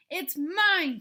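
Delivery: loud and high, grabbing at it; shouted claim. A narrator's reading. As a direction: yell that it belongs to you. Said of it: "shouted Cyril".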